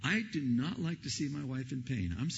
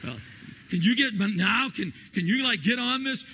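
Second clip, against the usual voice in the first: pained groaning plea; 7 semitones higher, 5 dB louder than usual. Retold or imitated. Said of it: "I go to bed".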